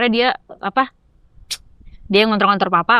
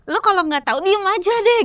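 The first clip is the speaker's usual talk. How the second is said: high-pitched exasperated retort, acted out; kesal; 10 semitones higher than usual. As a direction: inner grumble voiced high and annoyed. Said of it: "Kayak kesel banget gitu". (gerutu kesal dalam hati, nada tinggi)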